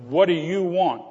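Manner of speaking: put-on a sassy teen barking at the cop; rude teenage retort. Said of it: "you didn't talk like that to adults".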